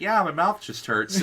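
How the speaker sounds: put-on hurt teen laughing it off; laughing reply. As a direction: shrug it off with a laugh. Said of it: "I'm like".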